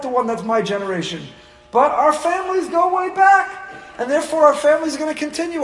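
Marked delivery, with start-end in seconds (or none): 1.7-5.6 rising shout. Real, not acted. Preacher raises voice.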